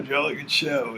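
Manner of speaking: stiff British accent, at ordinary pitch; uptight British. Shown as a demonstration. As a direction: play a very proper Brit. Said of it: "very British and very, very uptight".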